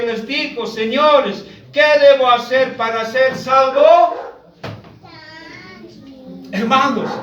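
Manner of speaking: ordinary delivery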